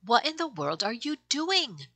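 high, curious puppy voice. Acted. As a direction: ask like a curious little pup. Puppies ask the poky puppy.

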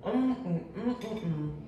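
pleased hums while tasting the sandwich; savoring hum. Real, not acted. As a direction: hum with pleasure over a bite.

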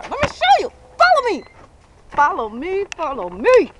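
high, loud call to follow; excited. Real, not acted. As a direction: call them along, thrilled. Excited lead to the tire.

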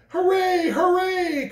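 high, loud hooray; cheering. A narrator's reading. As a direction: cheer at the top. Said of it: "cried the funny little clown".

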